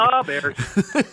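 hammed-up Chicago fan accent, pitched high; playful Chicago-accented team cheer. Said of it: "with the right accent".

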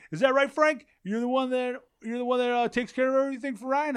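imitating the host in a high showy voice; mock Jericho voice. Host teasing the nervous guest, in a high mock voice.

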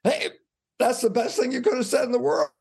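a jump of joyful realisation; excited. Retold or imitated. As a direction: blurt it out in sudden delight. Realising the critique helps him.

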